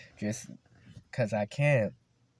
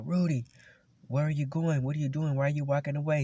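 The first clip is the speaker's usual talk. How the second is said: high-pitched mock yell, urgent questions; retold yelling teacher; 7 semitones higher, faster than usual. PE teacher calling after a student walking off, retold yell. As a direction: imitate a coach calling out angrily. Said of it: "I started yelling back".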